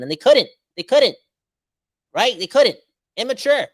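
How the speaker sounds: loud, high, hammering each word; a fan's real frustration boiling over; exasperated outburst. Real, not acted. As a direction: vent the frustration at full volume. Ranting at the team's leaders.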